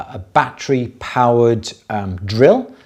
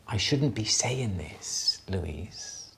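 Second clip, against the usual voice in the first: hushed secretive whisper; 2 semitones lower, 11 dB quieter, much slower than usual. Real, not acted. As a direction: lean in and whisper it. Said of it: "Why are you whispering?".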